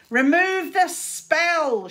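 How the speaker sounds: put-on a boy bellowing at his pinned rival; shouted order. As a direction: bellow each word. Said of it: "shouted Czar".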